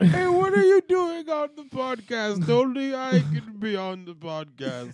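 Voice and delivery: slow, drawn-out comedian impression starting high; Bill Cosby. Other host challenges him on podcast, Bill Cosby impression. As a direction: stretch the words in character.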